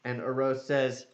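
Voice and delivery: ordinary delivery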